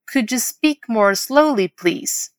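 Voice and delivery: whole model phrase. Shown as a demonstration. Teacher modelling a request to slow down, slow and connected. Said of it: "Vamos repetir a frase pra pegar bem?".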